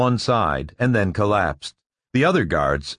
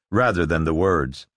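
ordinary delivery, nothing marked

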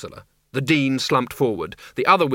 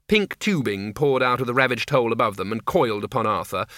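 ordinary delivery

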